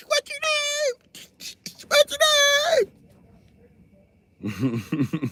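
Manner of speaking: high, drawn-out cop asking again; repeating cop. Retold or imitated. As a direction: ask slowly as if to someone foreign. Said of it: "They be like".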